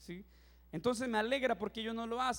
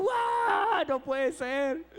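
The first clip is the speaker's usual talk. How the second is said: much higher and louder, slow, sobbing wail; crying out; 8 semitones higher, 6 dB louder, much slower than usual. Retold or imitated. Preacher crying at the movie's end: high, slow, sobbing wail. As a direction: break down at the song, drawn out. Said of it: "y yo llorando".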